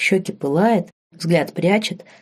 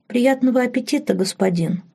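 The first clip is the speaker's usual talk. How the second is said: put-on higher, demure girl's voice; shy girl; 7 semitones higher than usual. A narrator's reading. Girl wishes her master a good meal, shyly. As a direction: say it high, eyes kept down. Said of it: "не поднимая глаз от тарелки".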